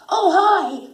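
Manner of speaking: high, loud, chopped-up greeting; overeager, choppy cartoon greeting; truncated dubbing delivery. Shown as a demonstration. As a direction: punch out each bit to hit the cue. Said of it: "And it's all, like, truncated".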